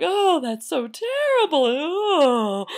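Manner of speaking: high, loud horrified wail; mock crying. Neighbors joining the crying, mock wailing. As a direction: lament it with a hammed-up howl. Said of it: "they started crying".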